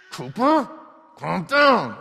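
put-on high, loud yell at a child; screaming and yelling. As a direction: imitate a dad losing his temper, yelling. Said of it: "it's me screaming and yelling".

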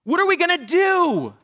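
high, loud panicked cry; mock-terrified wail; crying out in fear. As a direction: servant crying out to Elisha in panic. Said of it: "he cries out".

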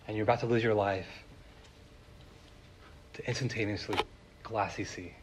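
normal talking voice

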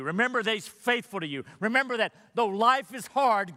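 fervent sermon climax; preaching at full force. Acted. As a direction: drive each remember home to the church. Climactic exhortation.

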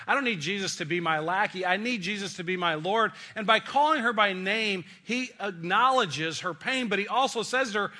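normal talking voice

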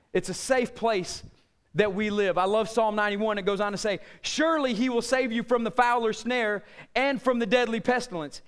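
ordinary talk

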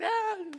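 high-pitched squealed "ah" of recoil; mock squeal. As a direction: squeal as if someone got too close.

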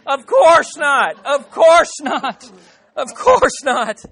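slow, loud, high emphatic denial. Acted. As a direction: belt it out slowly, hammering each repeat. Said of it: "you're shaking your head emphatically".

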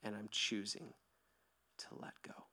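pastor modelling a whispered prayer of forgiveness. Shown as a demonstration. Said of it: "you have to take a moment to say".

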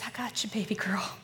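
soft whispered reassurance; tender whisper. Retold or imitated. Jesus comforting her in tears, tender whisper. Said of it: "he says".

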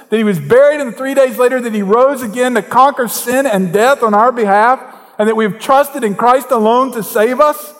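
high, loud preacher's build; preaching at full force. Acted. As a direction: raise the sermon to a fervent peak.

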